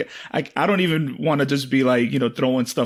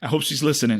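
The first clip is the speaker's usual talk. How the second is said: ordinary delivery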